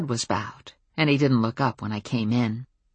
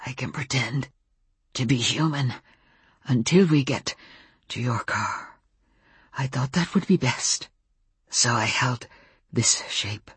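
halting wolf in human shape; 3 dB quieter than usual. A narrator's reading. Wolf explaining himself to the narrator, halting and subdued. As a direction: place each phrase carefully, as if words are unfamiliar. Wolf character speaking in Samuel's body.

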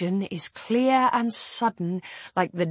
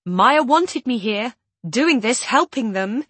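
higher and louder, desperate; desperate rising voice; 5 semitones higher, 8 dB louder than usual. A narrator's reading. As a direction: plead as the voice climbs. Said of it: "my voice rising with desperation".

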